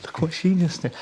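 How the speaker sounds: whisper-ish pride to someone else; delighted aside. Retold or imitated. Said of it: "then we turn away and go".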